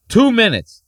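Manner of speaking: high, loud yell of two minutes; exasperated shout. Real, not acted. Yelling at the clip in disbelief, loud. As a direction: throw up your hands and yell it. Outraged repeat of the time left.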